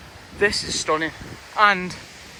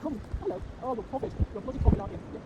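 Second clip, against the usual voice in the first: talking to the dog; 5 semitones higher, 9 dB quieter, much faster than usual. Real, not acted.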